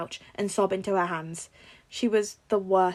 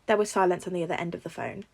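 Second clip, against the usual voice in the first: plain reading voice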